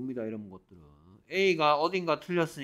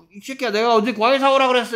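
fast, high mock tirade; mock scolding; 8 semitones higher, 6 dB louder, faster than usual. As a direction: imitate an angry parent rapidly berating. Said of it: "이러면서 혼낸다고?". (빠르고 높게 야단치는 엄마 흉내)

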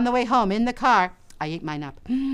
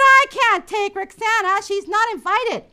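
annoyed big sister; 11 semitones higher, 5 dB louder than usual. Acted.

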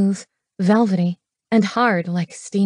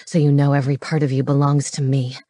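low possessive growl; low-pitched, dominant male voice; 6 semitones lower, faster than usual. Acted. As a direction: growl it low, claiming a lover. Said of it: "he growled".

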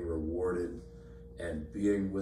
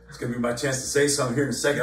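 quick, louder mutter to himself, waiting to jump in; rushed inner thought; 3 semitones higher, 7 dB louder, much faster than usual. Retold or imitated. Preacher waiting his turn in a couple's argument, rushed. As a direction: rattle it off under pressure. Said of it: "and I'm like".

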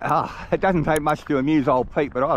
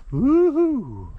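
much higher, loud whoop; joyful whoop; 13 semitones higher, 5 dB louder than usual. Real, not acted. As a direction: whoop out to the hills. Cheering at the view.

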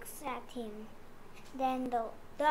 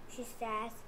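ordinary delivery

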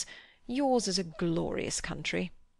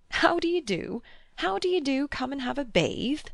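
chuckling; 8 semitones higher, 3 dB louder than usual. A narrator's reading. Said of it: "he chuckled".

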